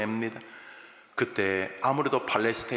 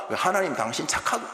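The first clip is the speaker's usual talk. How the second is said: sarcastic; 6 semitones higher than usual. Retold or imitated. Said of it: "이렇게 비아냥입니다".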